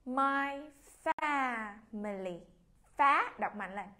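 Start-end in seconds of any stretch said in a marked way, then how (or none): 0.1-2.4 very slow, drawn-out My family; slow model reading. Shown as a demonstration. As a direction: read it slowly for the class to copy. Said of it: "Cô mời cả lớp chúng ta đọc theo cô". (đọc chậm rãi, kéo dài)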